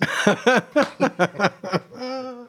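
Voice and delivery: high burst of real laughter; laughing. Real, not acted. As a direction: laugh hard at a rude punchline. Laughs at the rude punchline.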